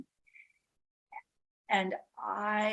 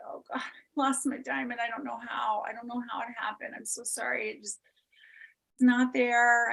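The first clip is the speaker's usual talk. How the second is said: higher, whispered tearful apology; tearful plea; 6 semitones higher, 5 dB louder than usual. Retold or imitated. Confessing the lost diamond to husband, tearful. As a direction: plead through remembered tears. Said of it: "I was crying".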